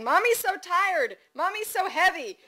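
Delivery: put-on high, loud sing-out from a little kid; toddler shouting. A narrator's reading. Toddler in bike buggy on Main Street, high shouting. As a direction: holler it like a gleeful-loud toddler. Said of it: "with a two-year-old in the back shouting".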